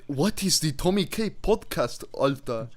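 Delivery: slow, mock German accent; hammed-up German streamer voice. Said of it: "That sounded more Swedish than German".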